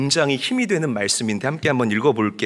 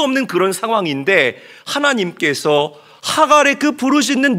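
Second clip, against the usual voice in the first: high, louder, impassioned sermon climax; fervent preaching; 8 semitones higher, 6 dB louder than usual. Acted. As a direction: drive the promise home to the congregation. Preaching at full force. (열정적으로 외치는 설교)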